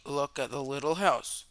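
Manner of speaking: plain reading voice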